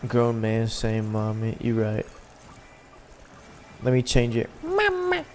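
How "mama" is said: tiny falsetto, much higher and softer; squeaky high mama. Pretend. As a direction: squeak it out like a baby voice. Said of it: "you gotta say it like that".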